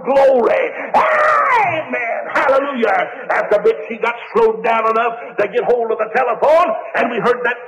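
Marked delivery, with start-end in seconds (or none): 0.1-3.3 high, crying shouts of praise; joyful hollering. Retold or imitated. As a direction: holler each word like a revival shout. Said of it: "a hollering".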